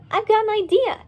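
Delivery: high, bright eureka; excited idea. Acted. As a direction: pop with the idea. Dolly's sudden excited idea.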